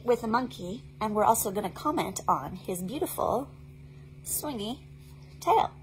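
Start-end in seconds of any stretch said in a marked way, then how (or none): none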